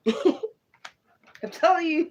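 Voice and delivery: laughing through the words; high, slow, can't stop laughing. Real, not acted. Laughing after the spirit voice.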